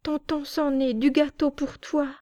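put-on high child's voice, earnest; little girl voice. Small girl offering cake to the noseless uncle, high childlike. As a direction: speak as a small girl on a mission.

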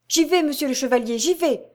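high, fast, hurried reply; eager servant. Acted. Jacques answers his master, fast and eager. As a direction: rush the answer back.

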